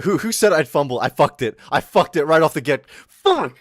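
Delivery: loud, exasperated rant; frustrated yelling. Real, not acted. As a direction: blow up at yourself. Frustrated ranting.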